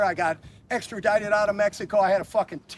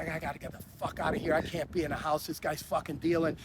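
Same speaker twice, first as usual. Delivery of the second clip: softer, quick hushed muttering to himself; inner panic; 3 semitones lower, 6 dB quieter than usual. Retold or imitated. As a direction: rush it low under your breath, like you need to get out now. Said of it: "And I'm thinking".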